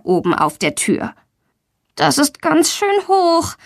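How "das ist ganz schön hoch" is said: put-on high, slow anxious child voice; uneasy. A narrator's reading. Girl atop the door looks down, slow and uneasy. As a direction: look down and balk, slowly. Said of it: "denkt sie".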